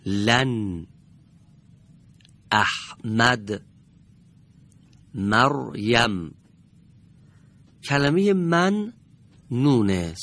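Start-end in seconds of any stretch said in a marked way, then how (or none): 2.5-3.6 احمد said slowly, the ح held and joined to the vowel before it; careful recitation. Shown as a demonstration. Quran teacher demonstrating احمد slowly to students. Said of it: "به این کلمات دقت بفرمایید". 5.1-6.3 مریم said slowly, the ر joined to the short a before it; careful recitation. Shown as a demonstration. Quran teacher demonstrating مریم slowly to students. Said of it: "به این کلمات دقت بفرمایید".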